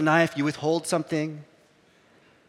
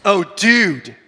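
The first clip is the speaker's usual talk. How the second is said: Michelle impression; 7 dB louder than usual. Pastor imitating a colleague's catchphrase for the congregation, exaggerated impression. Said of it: "I always do a Michelle impre".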